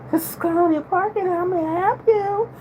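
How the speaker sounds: code-switch into a high, proper receptionist voice; professional phone voice. Shown as a demonstration. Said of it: "Code switch when in professional setting".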